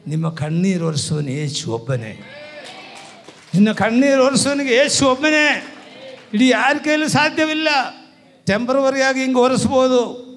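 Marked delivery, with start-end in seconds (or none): 3.5-10.3 high, loud proclamation; full-force preaching. Real, not acted. Preacher telling the congregation who wipes their tears, full-force preaching. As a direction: hammer it home to the congregation.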